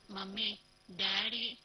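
put-on a sleepy little girl calling for her parents; slow calling child. A narrator's reading. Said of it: "అంటోంది".

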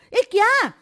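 sudden loud high-pitched blurt; blurted loudly. A narrator's reading. Girl startled by her friend's plan, blurting out a loud high question. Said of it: "હું જોરથી બોલી ગઈ".

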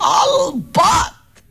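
high, very drawn-out exclamation; emphatic assent. Acted. Partner approving a jump to the third scene, emphatic. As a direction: stretch the word out high and emphatically.

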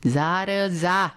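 slow, drawn-out sneer in another voice; mocking dismissal. A narrator's reading. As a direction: stretch it lazily to wave him off. Sampatrao mocks Baji. (संथ, ओढून केलेली टिंगल)

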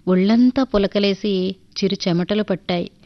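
plain reading voice

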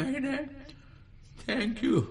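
put-on high, soft old man's voice. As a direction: thank them in a high, frail voice. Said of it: "ఒక వృద్ధుడు బస్సులో వెళుతున్నాడు". (ఎత్తైన మెల్లని ముసలి గొంతు)

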